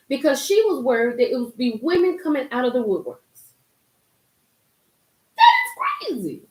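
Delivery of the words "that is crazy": shocked disbelief. Real, not acted. Reacting to the girl's story.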